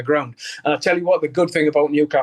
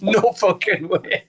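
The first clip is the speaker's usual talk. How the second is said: slow, laughing disbelief; 3 semitones higher, slower than usual. Retold or imitated. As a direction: stretch it out through a laugh. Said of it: "I went".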